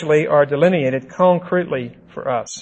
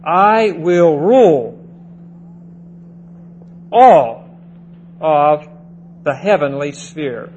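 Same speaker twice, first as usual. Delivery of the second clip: all hit much louder, with long pauses between words; emphatic boast; 3 dB louder than usual. Retold or imitated. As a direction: stress each word slowly, all the loudest. Preacher voices Satan's boast.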